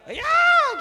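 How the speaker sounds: extremely high acted scream; sudden piercing yelp; heart-stopping shriek. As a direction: shriek in mock terror. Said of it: "కేక, గుండె ఆగిపోయేటట్టు".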